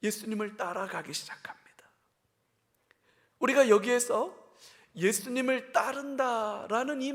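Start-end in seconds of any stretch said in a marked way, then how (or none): 0.0-1.9 whispered. Real, not acted. Whispered sermon aside.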